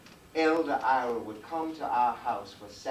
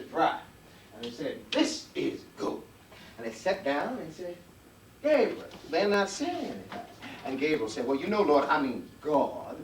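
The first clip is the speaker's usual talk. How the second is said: lively, higher folk-tale teller voice with big swings; Uncle Roy spinning a tale; 5 semitones higher, 5 dB louder than usual. Acted. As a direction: spin it like a front-porch storyteller to a child.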